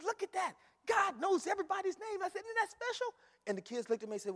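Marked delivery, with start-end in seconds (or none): 0.0-3.1 put-on much higher, delighted exclaiming to children; excited teacher voice. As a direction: wow the kids with the big discovery. Retelling his excited words to kids.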